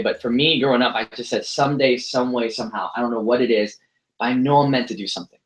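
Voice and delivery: ordinary delivery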